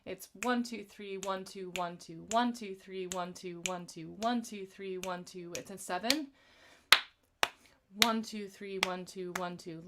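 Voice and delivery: rhythmic counting in time. Shown as a demonstration. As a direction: tap out the seven-count, leaning on one. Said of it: "talk a little bit about the rhythm".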